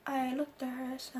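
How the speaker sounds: ordinary talk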